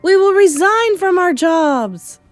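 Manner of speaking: high, loud chorus; shrieked eager echo; crowd cry. A narrator's reading. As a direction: cry it back like an obedient mob. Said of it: "they cried".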